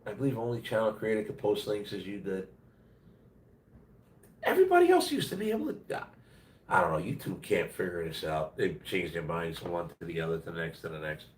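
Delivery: nasal voice, mostly gone; blocked nose, barely any voice; nasally. Real, not acted. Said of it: "sorry I'm so nasally".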